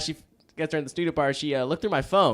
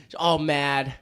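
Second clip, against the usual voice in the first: usual speaking voice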